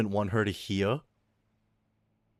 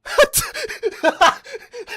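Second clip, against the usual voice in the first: high giggle; 11 dB louder than usual. Pretend. Laugh with high put-on voice.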